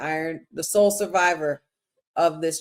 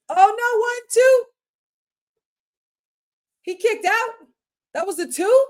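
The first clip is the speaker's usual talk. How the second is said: excited shout; 8 semitones higher than usual. Real, not acted. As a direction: scream at the near fall. Live reaction to a kickout.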